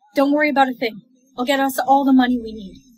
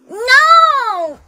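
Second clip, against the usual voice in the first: shouting no; 9 semitones higher, 4 dB louder than usual. Acted. Refusing a kiss.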